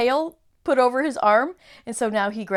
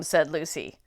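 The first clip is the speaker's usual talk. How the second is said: ordinary delivery, nothing marked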